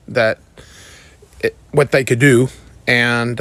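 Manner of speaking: ordinary delivery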